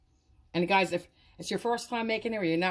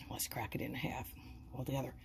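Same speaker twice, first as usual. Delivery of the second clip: soft whisper, almost secretive; hushed whisper; 5 semitones lower, 11 dB quieter than usual. Real, not acted. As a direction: whisper it while breaking the meatball. Breaking meatball open, hushed.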